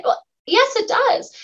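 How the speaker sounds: high, insistent correction; emphatic yes it does. Real, not acted. Host correcting the doubters, emphatic and high.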